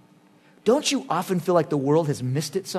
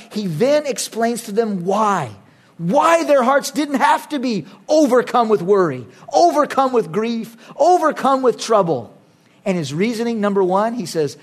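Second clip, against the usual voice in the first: usual speaking voice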